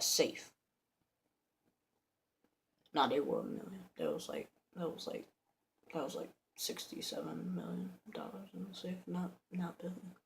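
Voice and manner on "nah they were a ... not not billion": very soft, near-whispered aside; sheepish correction. Real, not acted. Storyteller correcting his own exaggeration, sheepish hushed aside. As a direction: drop right down to correct yourself.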